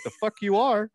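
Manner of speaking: high-pitched scolding, falling at the end; mock outraged mom. Imagined mom forbids her son to go rollerblading, mock outrage. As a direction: shut the kid down as an exasperated mother.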